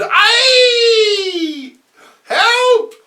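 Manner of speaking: high, loud screaming cry for help; woman screaming. Acted. Offstage woman screaming for help, high and loud. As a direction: shriek it in panic. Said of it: "a woman screams".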